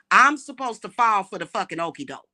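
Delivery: ordinary talk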